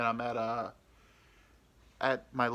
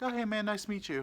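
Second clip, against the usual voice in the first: much higher, overly bright hello; fake friendly; 10 semitones higher than usual. Meeting her boyfriend, overly bright fake friendliness. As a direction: greet him too cheerfully.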